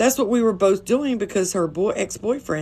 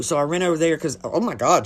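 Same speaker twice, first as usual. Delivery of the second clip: normal talking voice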